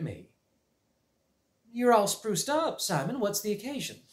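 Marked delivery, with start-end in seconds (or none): 1.7-4.0 lightly voiced young teacher. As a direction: ask with amused curiosity. Teacher addresses Simon.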